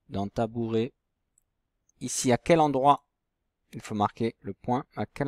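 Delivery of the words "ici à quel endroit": usual speaking voice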